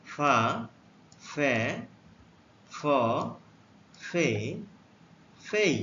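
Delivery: ফা, ফে, ফ, ফে, ফেই said one by one, slow and clear; teacher modelling ফা ফে ফ ফে ফেই; letter sounds demo. Shown as a demonstration. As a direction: model ফা ফে ফ ফে ফেই with a pause after each. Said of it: "এফ এর উচ্চারণ".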